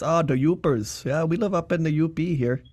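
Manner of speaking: put-on Upper Peninsula Michigan accent; Yooper accent. Showing how they talk up in the U P, Yooper accent. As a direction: imitate a Yooper, pitched a little up. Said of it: "if you get up to the U P".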